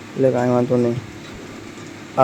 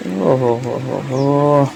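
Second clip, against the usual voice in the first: drawn-out mock oho; much slower than usual. Reacting to a scene in game.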